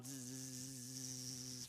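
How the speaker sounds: soft droning zzz like a fly; buzzing fly. Retold or imitated. Preacher imitates flies over the bull, soft buzzing. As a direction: hum a quiet fly buzz.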